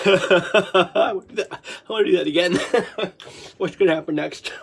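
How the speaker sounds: giggly words after a real fit; laughing. Real, not acted. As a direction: say it through bubbling laughter.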